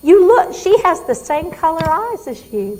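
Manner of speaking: high, loud, eager burst of recognition; excited recognition. Acted. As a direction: play the patient suddenly recognising her.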